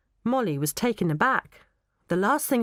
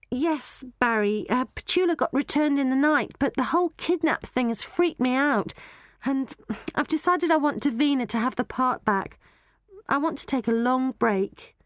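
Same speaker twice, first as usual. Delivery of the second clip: put-on higher-pitched voice for young Molly; Molly the girl; 7 semitones higher than usual. Molly telling Barry she wants a long break, higher girlish voice.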